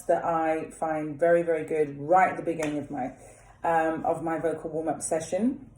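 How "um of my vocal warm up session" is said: ordinary talk, nothing marked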